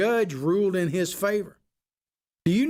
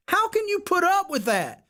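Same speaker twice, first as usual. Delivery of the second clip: high incredulous demand; 12 semitones higher than usual. Real, not acted. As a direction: voice leaps way up on the question. Challenging Democrat voters on air.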